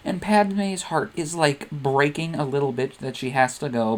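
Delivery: plain reading voice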